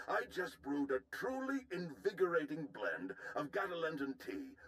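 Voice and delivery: lilting, sing-song alien officer voice; a fussy alien general who loves his tea. Acted. Alien general's high chirpy voice.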